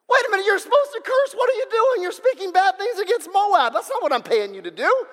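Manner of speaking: high, loud outraged protest; outraged king. Acted. King protesting the prophet blessed his enemies, outraged shout. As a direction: shriek it like a cheated king.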